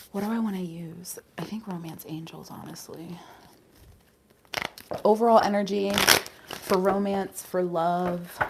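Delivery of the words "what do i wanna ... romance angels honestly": very soft whispered deliberation; whispering to oneself. Real, not acted. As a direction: think aloud in a fading whisper. Thinking to herself over cards.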